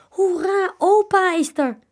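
high, loud joyful shout; children cheering at grandpa's arrival; happy cheer. A narrator's reading. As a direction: cheer as grandpa's car appears. Children delighted to see opa.

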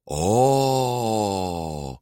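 long drawn-out collective "ohhh"; crowd's "oh". A narrator's reading. The crowd reacts in chorus, drawn-out oh. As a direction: stretch one falling oh. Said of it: "klonk een luid".

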